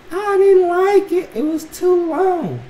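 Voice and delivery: put-on high, very slow kid voice; imitated little daughter. Daughter explaining her haircut to dad, mock child voice. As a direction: drag it out high like a small child. Voicing his young daughter's answer.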